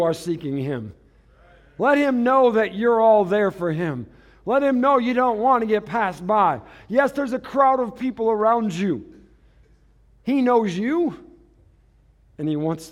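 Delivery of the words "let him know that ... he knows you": higher, louder preaching push; fervent preaching. Acted. Pastor urging the congregation to seek God, preaching loud. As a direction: drive it home to the church.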